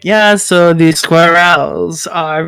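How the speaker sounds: slow, drawn-out debater imitation; mock a laboured, over-deliberate speaker. Friend imitating a debater's rambling intro, slow and deliberate. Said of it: "where J F is like".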